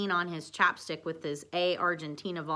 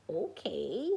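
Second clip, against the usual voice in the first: odd puppet voice, rising; lightly voiced puppet sage agreeing; Yoda. A narrator's reading. Puppet agreeing to talk to Lisa, odd rising voice. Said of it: "said Dwight, too".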